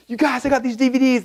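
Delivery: high-pitched, thrilled announcement; excited. Retold or imitated. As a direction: burst out with big news for your buddies. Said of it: "I was so excited".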